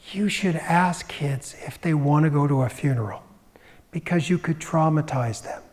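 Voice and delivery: hushed, breathy whispered quote. Retold or imitated. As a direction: whisper it like a secret warning. Quoting a personality's advice.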